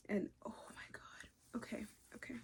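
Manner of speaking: soft stunned whisper; awed whisper. Real, not acted. Stunned by the book, whispering in awe. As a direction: barely breathe it.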